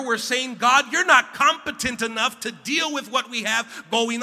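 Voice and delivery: rising, loud preacher's build to a shout; preaching at full force. Acted. Pastor preaching to the congregation, building to a shout.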